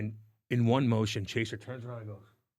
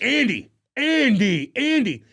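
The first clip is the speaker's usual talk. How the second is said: much higher, louder, slow calling of a name; 11 semitones higher, 8 dB louder, slower than usual. Retold or imitated. Calling Andy to the bench, loud and slow. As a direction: call him over from the bench. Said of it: "I'm like".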